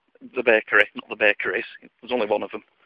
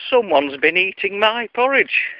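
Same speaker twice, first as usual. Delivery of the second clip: high, slow storybook bear voice; Mummy Bear; 7 semitones higher than usual. Acted. Mummy Bear finding her porridge eaten, high and slow. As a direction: lift the pitch and draw it out. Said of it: "Mummy Bear looks and says".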